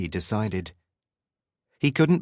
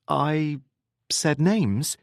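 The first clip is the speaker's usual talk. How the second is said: higher, lightly questioning tone; feigned casual; 6 semitones higher, much slower than usual. A narrator's reading. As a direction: ask it as if it hardly matters. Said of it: "sounding casual".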